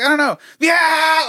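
high, loud imitated phone yell. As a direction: yell so it carries from a held-away phone. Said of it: "hold the phone away and I could hear it".

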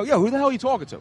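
high-pitched indignant comeback; retold angry challenge. Man turning on a rude driver, retold angry challenge. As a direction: act out squaring up for a fight.

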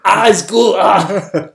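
loud mock cries round the words; Bill Burr doing Schwarzenegger. Comedian imitating Schwarzenegger with his maid, loud mock cries. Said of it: "his impression of Schwarzenegger sleeping with his".

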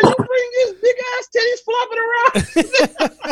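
high, slow mock screech; hammed-up shrieking scold; grandma screaming at the girl, high and slow. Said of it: "She said".

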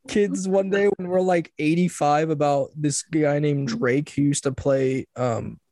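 ordinary talk

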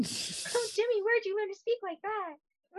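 put-on high, soft girlish voice; hammed-up ingénue admiration; swooning girl. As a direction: coo it like a smitten sweetheart. Playing the girl being wooed.

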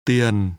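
"Tiền" on a low falling tone. Shown as a demonstration. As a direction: let "Tiền" slide steadily downward. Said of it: "pronounced with two low falling tones".

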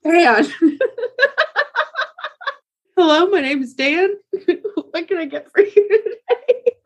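giggling through a cashier greeting; laughing mock greeting. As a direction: laugh while playing a waiter. Mocking a waiter named Dan.

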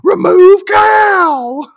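huge high-pitched bellow; hammed-up, drawn-out military holler; drill sergeant yell. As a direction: yell the order slowly at full blast, stretching each word. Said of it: "the sergeant yells something like".

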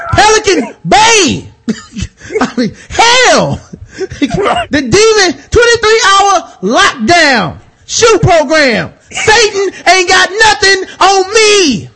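high, slow, hammered Training Day rant; Denzel. Acted. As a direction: boast defiantly, stretching out each threat. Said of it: "at the end of Training Day".

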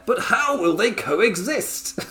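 high, slow, melodramatic question; mock dramatic. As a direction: parody a storyline promo. Mocking WWE storyline cliché.

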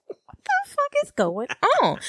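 high, shocked disbelief; incredulous. Retold or imitated. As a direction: sound baffled and appalled. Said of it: "I'm just like".

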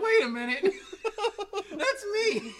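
high, loud, laughing disbelief; loud comic double take; astonished realization. Retold or imitated. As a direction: retell a sudden shock, voice jumping up. Hears own name called as winner.

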